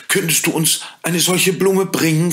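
put-on high, eager child voice; hopeful girl. A narrator's reading. Frieda asking the fairy for the flower, in an eager high voice. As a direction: ask eagerly like a little girl.

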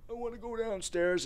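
put-on high whiny little kid voice. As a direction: mimic a four-year-old begging. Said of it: "he's screaming and yelling".

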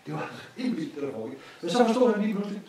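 normal talking voice

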